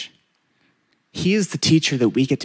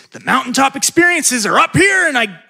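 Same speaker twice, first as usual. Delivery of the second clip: high pitched mountaintop; 12 semitones higher, 5 dB louder than usual. Acted. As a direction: voice lifted high and loud for up here. Pastor illustrates high mountaintop.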